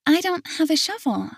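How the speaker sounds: put-on high, innocent child voice; little girl voice. Little girl answering her grandpa, high and innocent. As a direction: pitch it up like a small girl. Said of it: "she says with a confused look".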